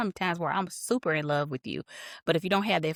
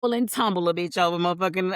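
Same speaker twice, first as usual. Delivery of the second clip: ordinary delivery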